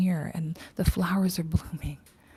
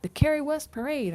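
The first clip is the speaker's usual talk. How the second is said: mocking eager friends; 8 semitones higher than usual. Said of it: "They'd be like".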